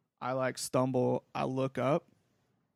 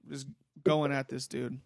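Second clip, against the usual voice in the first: ordinary talk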